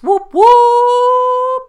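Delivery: loud high whooping cheer; excited cheer. Real, not acted. Celebrating six hundred listens.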